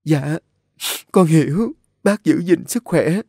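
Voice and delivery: tearful reassurance. A narrator's reading. Nhã Phương answers the dying woman, tearful. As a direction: comfort her through sobs, pitched high.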